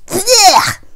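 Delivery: loud, high disgusted blech; disgusted spit. A narrator's reading. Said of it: "as he spat it out".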